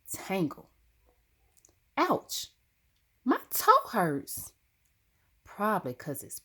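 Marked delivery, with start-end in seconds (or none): 2.0-4.5 high, sharp cry of pain; startled high yelp; sudden ouch. Acted. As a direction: yelp it as the toe hits. Toe hurt in a tumble.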